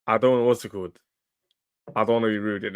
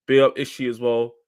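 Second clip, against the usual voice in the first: ordinary delivery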